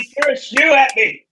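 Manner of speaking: high, hammed-up complaint; playing the wronged wrestler; mock victim wail. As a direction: tattle to the ref in outrage. Co-host joins mock complaint.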